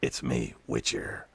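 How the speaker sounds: low growly monster hunter voice; Witcher voice. Pretend. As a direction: drop into the hunter's deep growl. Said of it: "I even sound like the Witcher".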